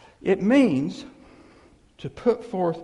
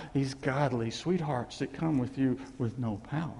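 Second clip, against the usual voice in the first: ordinary talk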